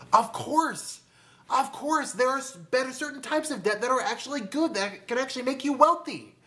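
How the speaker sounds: high-pitched, emphatic exclamation; emphatic agreement. Real, not acted. Speaker answering own question about good debt, excited insistence. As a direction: voice jumps up as if it's obvious.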